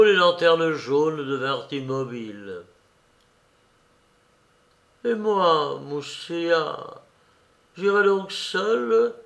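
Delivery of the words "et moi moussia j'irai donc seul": a mild touch of sadness, slower at the end; sad. A narrator's reading. Tania to Moussia, lightly sad question. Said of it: "dit tristement".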